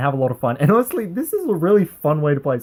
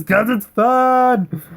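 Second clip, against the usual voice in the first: higher and drawn out very slowly, a touch louder; gleeful answer; 6 semitones higher, 3 dB louder, much slower than usual. Acted. Answering the opponent in a drawn-out gleeful voice. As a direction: stretch it out with glee.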